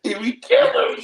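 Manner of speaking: high, squealing words through laughter; laughing so hard the words go up high; real laughter. Real, not acted. Host's teasing cracks him up.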